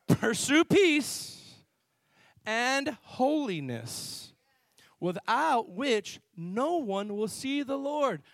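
very slow, stretched-out scripture quote; slow emphatic repeat. Real, not acted. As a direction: draw each word out for emphasis. Repeats verse slowly to silent congregation.

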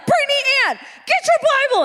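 high-pitched mock scolding call; imitated impatient dad. Dad calling his sidetracked daughter to get her Bible, mock scolding. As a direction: call out like a dad losing patience. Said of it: "my dad's like".

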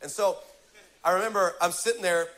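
normal talking voice

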